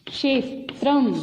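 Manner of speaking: क्षेत्रम said slowly with a crisp ksh cluster; careful pronunciation. Shown as a demonstration. Teacher modelling क्षेत्रम for young pupils. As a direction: model क्षेत्रम clearly, syllable by syllable. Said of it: "शब्द का उच्चारण किया".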